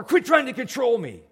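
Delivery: loud, high snap of resentment; angry outburst. Retold or imitated. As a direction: yell it back defensively. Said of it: "that was my interpretation".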